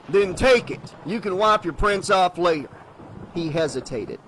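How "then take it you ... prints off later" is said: challenging sheriff. Acted.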